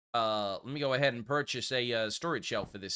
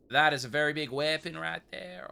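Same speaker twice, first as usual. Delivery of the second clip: normal talking voice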